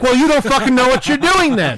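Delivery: loud, high-pitched indignant retort; heated comeback. Retold or imitated. Clerk's imagined comeback to a boastful plumber, loud and heated.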